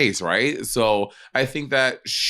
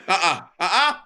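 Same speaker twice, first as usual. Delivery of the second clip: mock refusal; 5 semitones higher than usual. Emphatic refusal, mocking.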